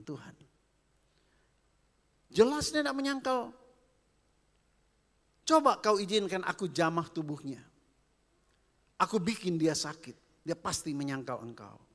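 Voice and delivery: loud, high, goading devil voice; devil's challenge. Retold or imitated. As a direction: dare God like a sneering devil. Iblis challenges God in story. (suara iblis menantang dengan keras)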